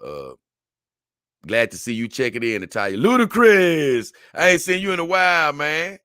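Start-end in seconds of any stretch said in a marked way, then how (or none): 3.0-6.0 excited greeting. Real, not acted. Greeting a returning viewer.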